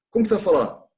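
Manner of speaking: ordinary talk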